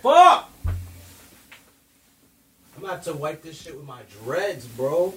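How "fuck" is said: sudden high yell of dismay; loud shout. Real, not acted. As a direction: shout it, caught off guard. Spills the drink.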